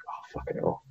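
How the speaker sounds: hushed under the breath "Oh, fucking hell"; a mock groan whispered in class; whispered exasperation. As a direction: whisper it so the professor can't hear.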